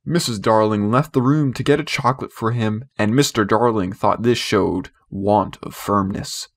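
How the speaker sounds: plain reading voice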